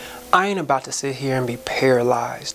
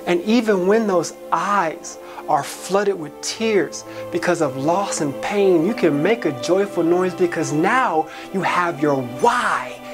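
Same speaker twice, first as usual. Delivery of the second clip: higher-pitched, impassioned spoken-word climax; rousing exhortation; 4 semitones higher than usual. Acted. Charging listeners to tear down the walls, rousing and high-pitched. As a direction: build it like a preacher's charge, pitch climbing.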